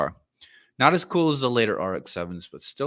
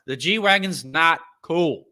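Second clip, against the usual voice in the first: slow, high, louder declaration; emphatic; 8 semitones higher, 5 dB louder, slower than usual. Real, not acted. Closing the rant to viewers with a loud verdict. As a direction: lay it down as final.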